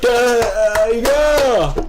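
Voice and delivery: hammed-up high, loud "yeah, yeah"; mock cheering. Mimicking the crowd's cheering, high and loud.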